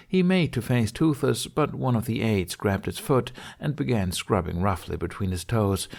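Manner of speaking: plain reading voice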